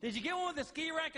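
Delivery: wife nagging husband at the airport; mock nagging wife. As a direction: pester him like a stressed wife. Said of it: "She goes".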